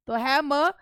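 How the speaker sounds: ordinary delivery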